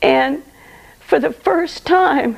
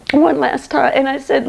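usual speaking voice

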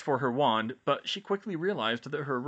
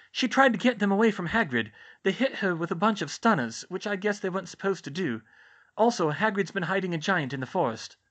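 high, raised voice for a student witch; Hermione's character voice; 8 semitones higher, 4 dB louder than usual. Acted. Hermione explaining McGonagall's stunning to George, high character voice. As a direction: explain it in a higher, livelier voice.

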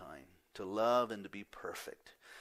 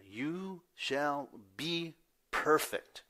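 very slow, high, each word spaced; commanding emphasis; 6 semitones higher, 4 dB louder, much slower than usual. Shown as a demonstration. Pastor stressing the command to the church, very slow and high. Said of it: "That's a command right there".

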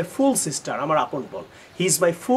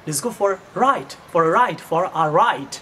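slow repeated model; much slower than usual. Shown as a demonstration. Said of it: "so try to say".

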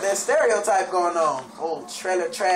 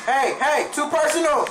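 startled shout; 8 semitones higher, 5 dB louder than usual. Real, not acted. Zombie too close in game.

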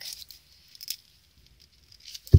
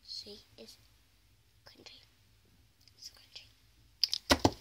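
whisper; 4 semitones lower, 12 dB quieter, slower than usual. Real, not acted. Slow whispered tease.

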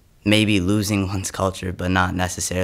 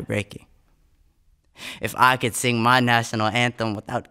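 intense, rising performance poetry delivery; spoken word poem; 5 semitones higher than usual. Acted. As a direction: perform the poem with mounting urgency.

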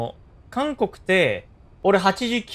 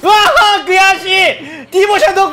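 frustrated outcry. Real, not acted. Frustrated outburst during the match.

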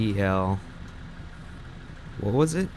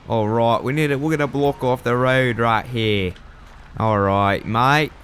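put-on amateur Australian accent, slightly raised voice; attempted Australian accent. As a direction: put on a mock Aussie accent to scold a driver. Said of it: "that's my attempt at a, uh, Australian accent".

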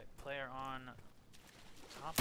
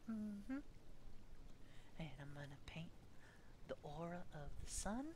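soft painting show narration; lightly voiced calm painter; Bob Ross voice; 8 dB quieter than usual.

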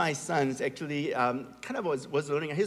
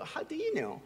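high, softer imitation of a doubting toddler; child with attitude; 10 semitones higher, 6 dB quieter than usual. Little son doubting his dad about a book, with attitude. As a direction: pipe up like a cheeky three-year-old. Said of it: "he says it with an attitude".

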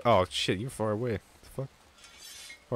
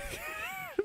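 squeaky high laugh; 12 dB quieter than usual. Real, not acted. Funny moment in game.

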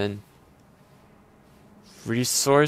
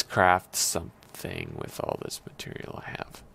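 whisper; 8 dB quieter than usual. Pretend. Sneaky plan in game.

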